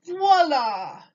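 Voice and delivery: high, loud showy French exclamation; milliner's flourish. Acted. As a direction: present the hat with a grand flourish. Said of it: "said the milliner".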